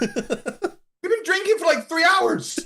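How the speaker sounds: very high, drawn-out squeal; mock disbelief. Retold group's amazed reply to free drinks.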